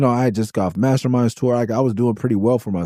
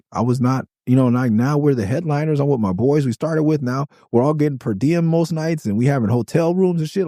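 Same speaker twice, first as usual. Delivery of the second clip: ordinary delivery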